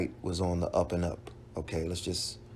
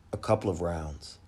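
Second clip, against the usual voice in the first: ordinary talk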